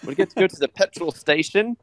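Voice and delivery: high-pitched teasing British voice; mock British accent. Teasing the guest who turned British, mock British accent. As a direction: put on a high, questioning British lilt. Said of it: "you just turned British for a second".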